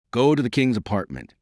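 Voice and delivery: ordinary delivery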